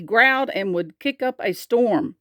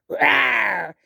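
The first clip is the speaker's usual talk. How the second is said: little dinosaur roar; growly storybook roar; 3 semitones higher than usual. A narrator's reading. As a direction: roar like a small fierce dinosaur. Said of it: "roaring his loudest roar".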